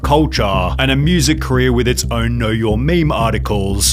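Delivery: loud, slow, hyped documentary voice; dramatic narration. Acted. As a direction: narrate it like a doom-laden exposé. Dramatic commentary narration parody.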